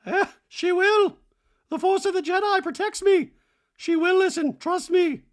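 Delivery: very high, loud, hammed-up mystic; Dorit as Jedi. Dorit invoking the Force, mock Jedi. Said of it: "like, Dorit's a Jedi".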